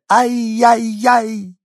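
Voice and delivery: very high, drawn-out yelping shout; little dog wailing in the lady's arms, high yelp. A narrator's reading. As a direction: howl it like a small dog.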